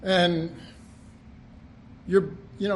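usual speaking voice

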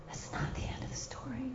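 hushed, voiceless repeat; whisper. Real, not acted. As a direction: whisper it to let it sink in.

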